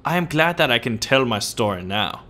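put-on storyteller in a hammy German accent; terrible German accent. Old tale told to son, mock German accent. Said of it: "terrible German accent".

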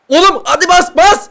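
high excited shout at the screen; rising yell of encouragement; urging shout. Real, not acted. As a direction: push the player forward. Urging player in game.

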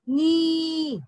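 नी sung out on a long, held ee; long ee sound. Shown as a demonstration. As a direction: stretch नी out clearly for pupils. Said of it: "फिर उसका उच्चारण करना है". (नी को लंबी ई से खींचकर)

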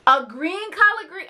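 incredulous question. Retold or imitated. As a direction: ask it like it makes no sense. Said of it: "And she's like".

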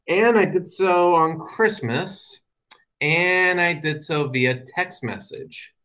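slow, drawn-out confession; deadpan slow reveal. Real, not acted. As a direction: let each word land slowly. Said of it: "dramatic pause reveal".

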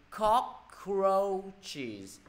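COCK-ROACH-ES in three drawn-out syllables. Shown as a demonstration. Teacher adding the plural ending, slow three-syllable demonstration. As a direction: stretch "Cockroaches" so every syllable is countable. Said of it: "Mấy âm tiết mọi người?".

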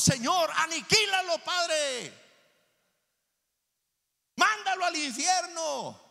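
high, loud mock plea to God; vengeful prayer shout. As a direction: cry out for heaven to strike them down.

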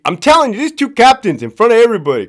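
usual speaking voice

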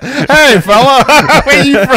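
put-on Italian accent. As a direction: ham up a laughing Italian soldier. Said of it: "With the accent".

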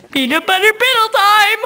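ordinary talk

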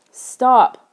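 a touch of choking alarm in a loud cry; choked out. A narrator's reading. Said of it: "I finally choked out".

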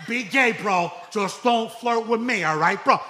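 loud and slow, bro to bro; big loud warning to the guy; emphatic bro shout. Acted. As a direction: lay down the rule loudly. Comic shouting bro punchline.

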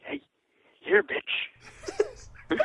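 high, laughing squeaky taunt; quoting a movie character; laughing mock line. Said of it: "he's like".

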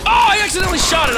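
fast high panicked blurt; frantic. Real, not acted. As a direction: rush it out in shock. Shot own dog by accident.

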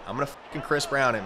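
normal talking voice

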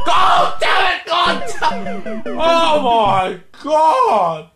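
slow drawn-out furious yells; angry shouting. Real, not acted. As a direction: rage at the game. Repeated game deaths.

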